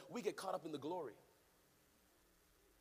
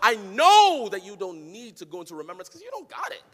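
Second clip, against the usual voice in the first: preaching at full force; 7 semitones higher, 18 dB louder than usual. Acted. Pastor urging the congregation to pray boldly, loud chanted preaching. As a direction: climb into a sung, shouting sermon climax. Preaching crescendo over music.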